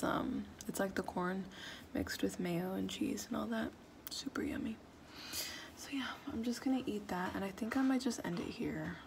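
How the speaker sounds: near-whispered, worn-out vlog talk; barely above a whisper, drained; soft tired murmur. Real, not acted. Signing off to viewers after a long day, tired whisper.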